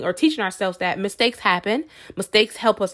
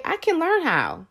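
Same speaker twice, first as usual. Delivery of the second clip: higher, slow, lilting rise; bright affirmation; 8 semitones higher than usual. Shown as a demonstration. Vlogger modeling the positive phrase, higher and slow. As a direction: sing it out hopefully.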